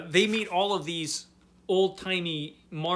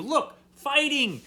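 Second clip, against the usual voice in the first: much higher and slow, pointing out the fight with glee; excited; 9 semitones higher, 4 dB louder, slower than usual. Real, not acted. To viewers about the comic panels, excited and higher.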